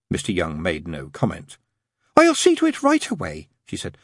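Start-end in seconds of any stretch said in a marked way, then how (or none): 2.2-3.5 very high, eager nun voice; eager, helpful nun. A narrator's reading. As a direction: offer help eagerly, pitched way up. Nun eager to fetch tea.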